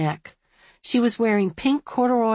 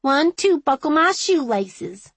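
put-on high, loud little-girl voice; dignified child's retort; 9 semitones higher, 5 dB louder than usual. A narrator's reading. Little girl retorting to the woman, dignified. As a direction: count back defiantly like a small child. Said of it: "she retorted with dignity".

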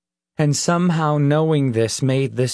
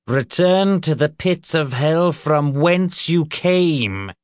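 higher, slow declamation; commanding exorcism; 6 semitones higher, 3 dB louder, slower than usual. A narrator's reading. Reverend sends the demon to hell, commanding and slow. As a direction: banish it with rising preacherly force.

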